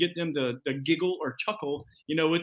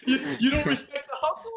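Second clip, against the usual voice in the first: laughing mock indignation; 8 semitones higher than usual. Acting out a rebuffed caller.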